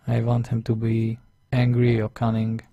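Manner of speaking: ordinary talk, nothing marked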